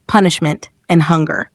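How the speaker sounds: plain reading voice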